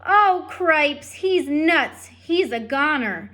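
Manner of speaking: loud, high alarmed shout. Acted. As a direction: yell it in disbelief. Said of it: "shouted Furlow again".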